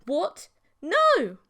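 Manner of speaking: high, loud shout of protest. A narrator's reading. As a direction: yell it in alarm. Protesting loudly.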